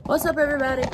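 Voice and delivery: ordinary delivery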